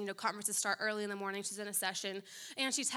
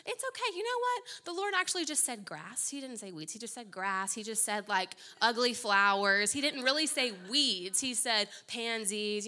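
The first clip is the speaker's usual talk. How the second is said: a syrupy, letting-off-the-hook voice; mock people-pleaser. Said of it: "we wanna say".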